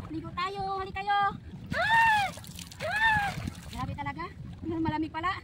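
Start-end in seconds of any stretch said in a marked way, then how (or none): 1.7-3.3 high startled shriek; shriek at cold water. Real, not acted. Jumping into the cold river.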